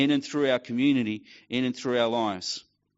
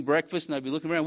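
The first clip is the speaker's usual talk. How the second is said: ordinary talk, nothing marked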